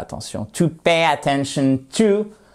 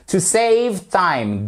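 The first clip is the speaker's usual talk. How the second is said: "To save time" drawn out slowly, higher; slow model phrase; 6 semitones higher, much slower than usual. Shown as a demonstration. Teacher models the phrase slowly for learners. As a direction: stretch every word of "To save time". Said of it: "slow model phrase".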